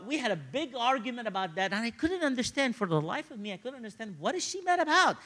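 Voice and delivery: ordinary talk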